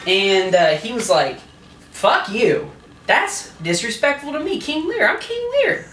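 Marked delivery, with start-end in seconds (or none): 1.9-5.8 rising, squeaky indignant king; mock outraged king. King snapping at his daughter, climbing into a squeak. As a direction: get higher and higher as the king takes offence. Drunk retelling, Lear outraged.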